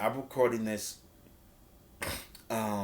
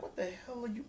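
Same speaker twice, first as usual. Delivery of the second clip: bewildered; 12 semitones higher, 8 dB quieter, much faster than usual. Retold or imitated. Wrestler confused, looking around.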